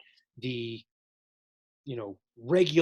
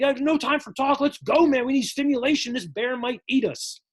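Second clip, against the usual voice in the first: much higher and louder, no time to waste; impatient shout; 8 semitones higher, 5 dB louder than usual. Acted. Hypothalamus cutting off the pituitary, high and loud. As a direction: bark it out like a bear is coming.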